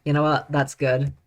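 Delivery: ordinary talk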